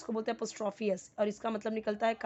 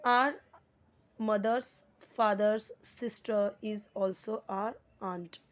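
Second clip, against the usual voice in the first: each word spaced out, very slow; slow dictation; much slower than usual. Shown as a demonstration. Teacher reading a sentence aloud to the class, slow and deliberate. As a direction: read it word by word for students. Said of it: "teacher reads example sentence".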